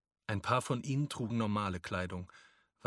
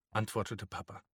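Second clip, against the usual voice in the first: plain reading voice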